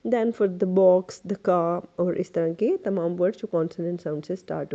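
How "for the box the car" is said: THE said "thuh" before box and car; short "thuh" in each THE; consonant-sound article. Shown as a demonstration. Teacher lists consonant words, THE as "thuh". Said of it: "उनके साथ आप the लगाओगे".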